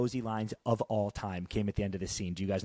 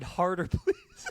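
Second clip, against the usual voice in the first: slow, higher, laughing mid-quote; 7 semitones higher, much slower than usual. Retold or imitated. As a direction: quote it while cracking up. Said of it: "He teaches Shane two things in Russian".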